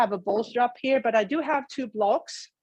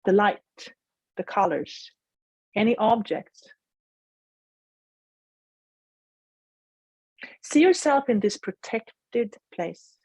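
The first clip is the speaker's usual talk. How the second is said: guided meditation. Acted. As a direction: gently guide them inward.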